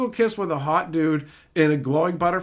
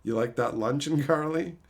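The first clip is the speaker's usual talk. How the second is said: much lower and softer teasing; 7 semitones lower, 6 dB quieter than usual. Retold or imitated. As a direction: tease her in a low sultry voice. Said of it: "I'm like".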